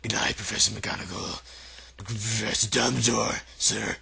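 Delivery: low, muffled giant's voice. A narrator's reading. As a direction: talk as if through a handkerchief. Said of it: "said Hagrid in a very muffled voice".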